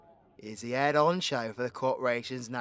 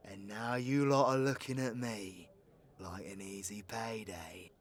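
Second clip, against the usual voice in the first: hurt, quiet reproach; 6 semitones lower, 10 dB quieter than usual. Acted. Outlaw to community eyeing the bounty, quietly bitter. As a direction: voice dropping to nearly a whisper.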